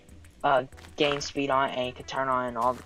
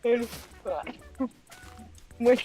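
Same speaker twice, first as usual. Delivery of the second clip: laughing; 9 semitones higher than usual. Real, not acted. Telling how the teacher crashed, still laughing. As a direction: push the words out mid-laugh.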